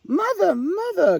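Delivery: very high, loud calling out to mother; an owlet calling happily after her mother; eager cry. A narrator's reading. Little Owl chasing her mother across the glade, high eager call. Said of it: "cried Little Owl".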